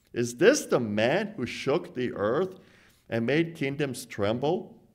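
ordinary talk, nothing marked

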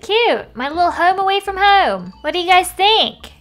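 high, loud proud gush; excited gushing. Real, not acted. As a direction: show it off, thrilled. Shows off the finished house.

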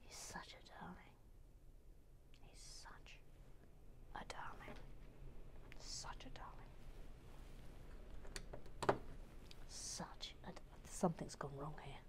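very soft whisper to oneself; hushed self-talk. Real, not acted. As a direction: whisper it absently under your breath. Murmuring to self while building.

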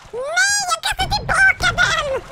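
very high squealed exclamation; high-pitched gamer panic; panicked outcry. Real, not acted. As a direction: squeal it in dismay at a blunder. Panicked mistake in game.

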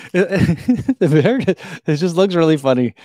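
laughing through the words; giggling. Real, not acted. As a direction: giggle while you talk. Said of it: "why it's making me giggle".

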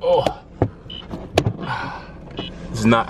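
normal talking voice

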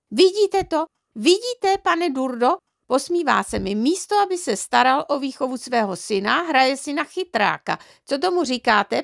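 high-pitched outraged appeal; indignant mother. A narrator's reading. Mother complaining to neighbour about laughing father, indignant. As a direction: complain shrilly to a witness.

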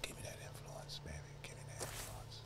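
greedy whisper. Real, not acted. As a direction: whisper it hungrily. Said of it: "I don't know why I'm whispering".

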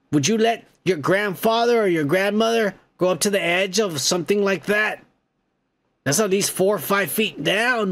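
normal talking voice

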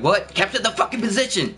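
hammed-up disbelieving echo; mock baffled callout. Acting teammates' reaction and order.

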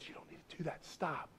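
whispered voice of Satan; Satan tempting the believer, whispered; Satan's whisper. Acted. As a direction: hush it like a tempter in the ear. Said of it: "And Satan says".

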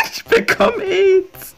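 high stretched mocking wail; mock whine. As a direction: mimic his whiny cry, slowly. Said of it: "mocking teammate's wail".

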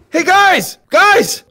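high, loud hail; calling out. Acted. Climber hailing the guys on top, high shout. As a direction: holler up high to get their attention.

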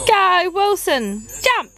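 high, drawn-out call urging a jump; cheering on dog. Real, not acted.

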